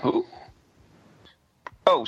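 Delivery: usual speaking voice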